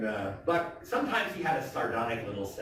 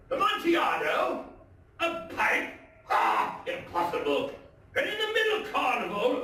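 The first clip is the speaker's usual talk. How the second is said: higher, eager tipsy reveller; drunk Fortunato's excitement. Acted. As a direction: squeal it like a delighted drunk. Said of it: "said he".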